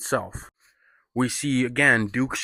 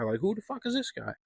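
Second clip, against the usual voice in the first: higher, softer put-on sneer; mocking pub crowd, repeated; 9 semitones higher, 8 dB quieter than usual. Pub regulars mocking the stranger again, higher softer sneer. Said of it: "And everybody's like".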